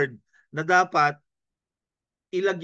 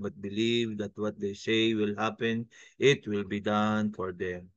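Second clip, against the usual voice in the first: low, level monotone reading of scripture; 7 semitones lower, 4 dB quieter than usual. Shown as a demonstration. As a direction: read it flat, with no rise or fall. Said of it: "Wag po monotone ang ating boses". (binasang mahina at walang pagbabago ang tono)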